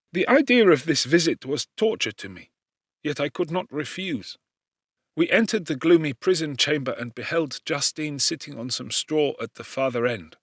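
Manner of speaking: plain reading voice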